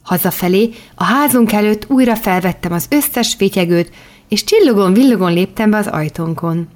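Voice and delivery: usual speaking voice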